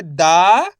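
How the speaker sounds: दा stretched long with a rising end; दा pulled out slowly, pitch sweeping up; drawn-out syllable. Shown as a demonstration. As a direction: hold the long vowel in दा and lift it. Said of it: "खींच के पढ़ेंगे".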